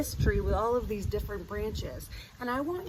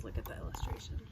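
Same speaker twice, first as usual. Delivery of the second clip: whispering; 13 semitones lower, 10 dB quieter, much faster than usual. Real, not acted. Quiet aside.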